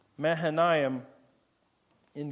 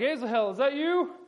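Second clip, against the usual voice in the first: higher, calling back over the shoulder; fleeing commander calling back to his pursuer, raised voice; yelled back over the shoulder; 7 semitones higher than usual. Retold or imitated. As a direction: call behind you to the man chasing. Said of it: "yells over his shoulder".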